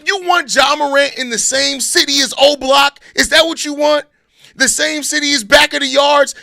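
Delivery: loud, high-pitched fake rant at chat, shouting; mock outrage. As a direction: ham up the outrage, yelling at chat for fun. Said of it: "I'm just having fun".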